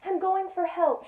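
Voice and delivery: usual speaking voice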